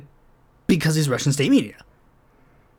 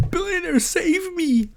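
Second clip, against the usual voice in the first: much higher, hammed-up desperate cry; mock scream; 14 semitones higher than usual. Mocking Galloway begging a billionaire for help, a high mock wail. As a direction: wail for the billionaire to rescue you. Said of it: "gonna scream to a billionaire".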